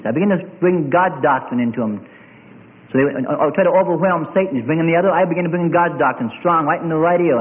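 ordinary talk, nothing marked

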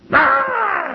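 high, loud screaming squeal; imitated badger squeal. Cornered badger turning on the man, squealing. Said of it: "just squealing at him. He said it sounded like almost a child screaming".

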